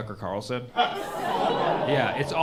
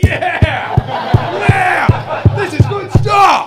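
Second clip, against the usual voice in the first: loud high cheering, much higher and louder; mock cheering Congress; 10 dB louder, much slower than usual. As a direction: cheer like a crowd gone wild. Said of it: "They'd be like".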